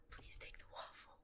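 voiceless, barely-there whisper; hushed, very faint offering; timid whisper. Real, not acted. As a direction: whisper a nervous plea.

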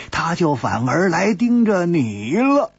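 chuckling as the line ends; sly, amused laughter; laughing. Acted. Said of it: "蝴蝶花暗暗好笑".

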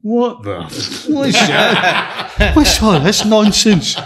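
high, loud, slow bewildered outburst with laughter; a laughing, disbelieving cry pitched high; imagined baffled rival. Retold or imitated. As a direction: play the rival gawking at the scribbled code, cracking up. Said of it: "he must have been going".

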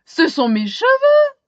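high, loud excited cry; exclaiming. A narrator's reading. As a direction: call out in surprise. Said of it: "s'écrie-t-il".

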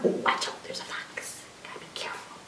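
urgent whisper, mock alarm; hushed warning. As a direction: whisper it so the fox won't hear. Pretend warning about a fox.

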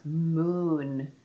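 "Moon" with a long hummed m and vowel; stretched sounds. Shown as a demonstration. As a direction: draw out "Moon" slowly. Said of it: "Those are stretchable sounds".

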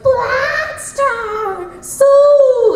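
high, very drawn-out battle cry; shouted attack call. Retold or imitated. Imitating the character's attack call, high and stretched. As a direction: stretch the call like an anime hero. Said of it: "he's like".

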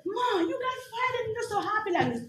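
high-pitched, lightly voiced child; imitating her son. Son asks fired mom why she's happy, high-pitched. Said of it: "And then my son said".

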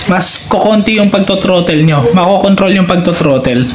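usual speaking voice

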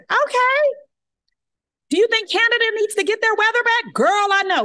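very high, loud sing-song greeting; bright high-pitched phone hello; chirpy phone voice. Retold or imitated. Her chirpy chat with operators.

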